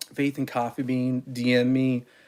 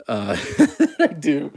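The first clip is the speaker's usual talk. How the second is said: laughing; 3 semitones higher, 5 dB louder, slower than usual. Real, not acted. Jokes about his goofball antics.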